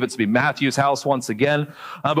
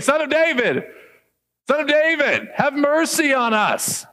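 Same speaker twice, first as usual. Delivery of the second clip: pleading cry; 10 semitones higher than usual. Retold or imitated. Blind men crying out to Jesus, high pleading call. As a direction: call out desperately, stretching the last words. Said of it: "They're saying".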